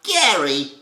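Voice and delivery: loud, high, very nasal character call; over-the-top nasal cartoon shout. Shown as a demonstration. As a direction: call out up high, nostrily, with a little gravel. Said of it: "up as high as you can possibly get it".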